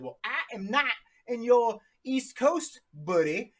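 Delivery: high, put-on voice, stretched out slowly; Denny's voice. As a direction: mimic a friend who teases about time zones. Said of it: "This is how Denny talks".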